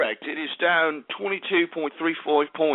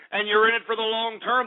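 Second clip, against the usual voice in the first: ordinary delivery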